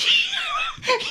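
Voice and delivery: short high squeal of laughter. Real, not acted. Laughs at losing her thread.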